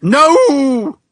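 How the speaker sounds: hammed-up loud shout of despair; mock anguished cry. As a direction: yell it like fake grief. Mock anguish at Amy's death.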